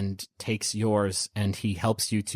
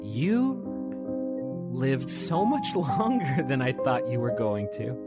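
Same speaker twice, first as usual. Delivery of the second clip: Julia, lightly voiced; 3 semitones higher than usual. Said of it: "and she says".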